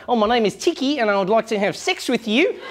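mock New Zealand accent, ending on a rise. Comedian as a New Zealander flirting, New Zealand accent. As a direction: imitate a mild, polite New Zealand accent. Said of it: "like in New Zealand".

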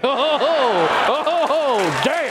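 real laugh, breaking into oh, oh; laughing at his own line. Real, not acted.

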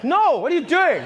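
high, laughing mock teacher; hammed-up scolding teacher; shocked teacher. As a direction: stop the kid mid-song. Teacher voiced, correcting.

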